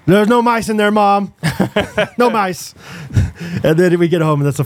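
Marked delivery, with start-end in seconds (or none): 0.1-2.7 lightly voiced loud toddler, pitched up; higher, matter-of-fact kid announcing; Bo's loud voice. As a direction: imitate a small son calling to mom. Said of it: "he's always loud".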